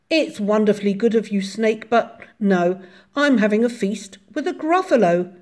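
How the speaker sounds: brisk, lightly voiced mouse; polite mouse. A narrator's reading. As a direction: decline the snake's invitation cheerfully.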